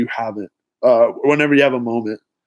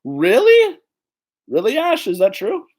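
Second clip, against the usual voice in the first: much higher squeaky disbelief; mock incredulous quote; 7 semitones higher than usual. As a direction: play a viewer asking in disbelief. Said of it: "Mia said".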